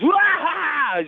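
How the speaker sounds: high, loud rock-frontman yell. Retold or imitated. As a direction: imitate a singer's wild high shriek. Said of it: "He's doing his [name] thing".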